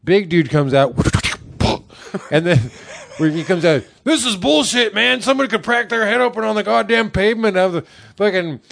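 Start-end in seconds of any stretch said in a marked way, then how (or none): 4.0-8.6 high, hurried indignant complaint; mock angry rant. Storyteller as big dude who just fell, high mock rant. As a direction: rant in a pitched-up outraged voice.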